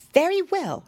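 high-pitched storybook mouse; small, high creature voice; little mouse. A narrator's reading. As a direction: advise as a clever tiny mouse. Mouse agrees to help.

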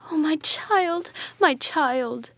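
high, drawn-out motherly lament; acted tearful cry of a grieving mother. A narrator's reading. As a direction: wail it in grief, pitch lifted. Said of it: "in a flood of tears".

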